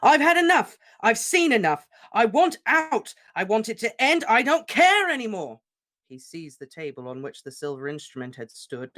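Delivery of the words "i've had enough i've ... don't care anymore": much higher and louder raging shouts; wild furious tirade; furious shouting. Acted. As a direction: scream out his despair. Harry raging, smashing things.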